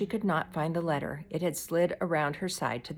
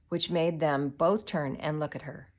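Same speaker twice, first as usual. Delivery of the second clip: usual speaking voice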